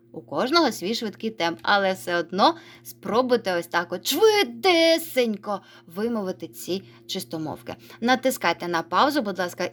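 usual speaking voice

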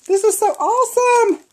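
very high, loud thrilled exclamation; excited delight. Real, not acted. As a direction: squeal it with real delight over a gift. Delighted at unwrapped gift.

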